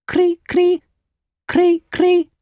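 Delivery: very high, squeaky cricket chirps; piercingly high little chirrups. A narrator's reading. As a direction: imitate a cricket in short, high bursts. Narrator imitates the cricket's chirping.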